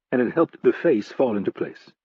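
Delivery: plain reading voice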